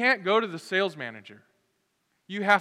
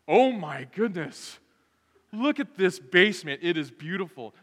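mock amazement; 6 dB louder than usual.

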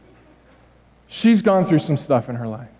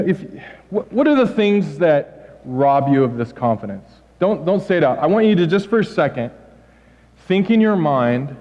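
ordinary talk, nothing marked

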